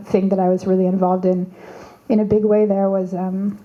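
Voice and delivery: ordinary talk, nothing marked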